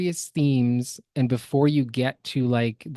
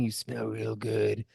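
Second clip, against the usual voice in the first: softer, slow creepy snarl; snarling creep; 3 semitones lower, 7 dB quieter than usual. Retold or imitated. Friend imitating a creepy dude snarling at a girl. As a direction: growl it like a predator. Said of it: "snarling and saying".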